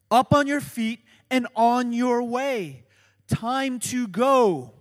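Up on your feet pushed out loud, Time to go slowed; urgent and loud. Shown as a demonstration. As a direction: preach it with urgency. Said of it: "contain that urgency".

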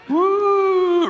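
a high, loud spooky "woo" like a ghost teasing. Retold or imitated. Pastor teasing the congregation about Jesus scaring disciples, spooky woo. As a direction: hoot it like a playful spook.

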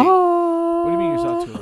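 high, loud disbelieving question; can't believe what he just heard; sudden squeak of disbelief; incredulous. Real, not acted. Disbelief at friend's remark.

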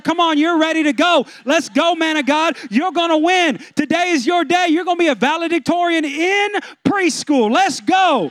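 put-on loud hyped coaching of a child; hammed-up coach pushing a preschooler; pushy parent pep talk. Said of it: "and you're like".

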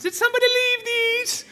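very high, loud hammed-up English widow; English accent. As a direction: call it out in a piercing mock English accent. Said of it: "I won't do the accent again".

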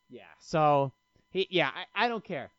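ordinary talk

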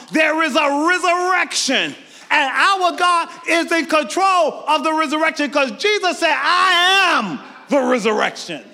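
stirring a church to praise; full-force preaching. Acted. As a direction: preach it out, rising and ringing.

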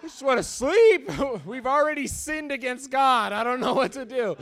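high, loud mock desperation; exasperated plea. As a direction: plead hammily to be allowed to sleep. Retold plea to sleep mid-fight.

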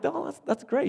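high, smiling, politely impressed; polite smile. Retold or imitated. Preacher retells smiling back at the car salesman. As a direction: answer with a put-on polite smile. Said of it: "I just kinda smiles like".

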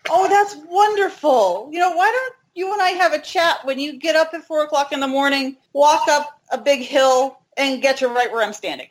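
high, put-on mock delight; sarcastic comeback. Retold sarcastic comeback to the stranger.

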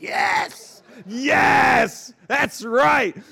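loud, building shouted affirmations; acted defiant yell; defiant dad. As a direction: own it to a staring room. Dad shouting at silenced restaurant.

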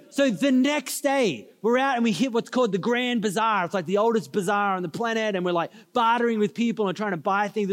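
normal talking voice

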